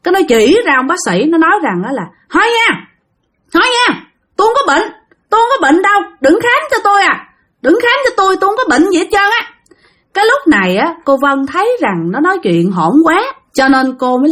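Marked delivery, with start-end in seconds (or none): 2.3-9.5 put-on high, insistent child refusing; a frightened boy pushing the doctor away; child protesting.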